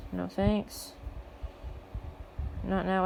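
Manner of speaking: usual speaking voice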